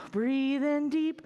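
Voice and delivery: slow, calm breathing cue; guided breathing. Shown as a demonstration. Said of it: "We're gonna breathe in deep".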